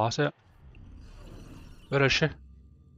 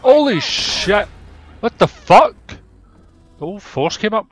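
shocked shout; 9 semitones higher, 12 dB louder, slower than usual. Real, not acted. Startled by police arrival.

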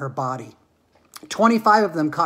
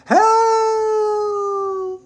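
long, high falling-man cry; shouted; 6 dB louder than usual. Acted. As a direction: yell for help while toppling over. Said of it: "he shouted".

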